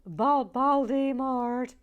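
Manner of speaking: loud high shout of the name. Retold or imitated. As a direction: yell it across the room. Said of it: "she just shouts".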